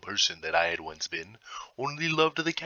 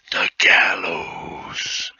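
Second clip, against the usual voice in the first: slow, breathless whispered dread; a slow horrified whisper; 5 semitones lower, 6 dB louder, much slower than usual. A narrator's reading. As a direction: breathe the word out as if it horrifies you. Dread-filled word in confession.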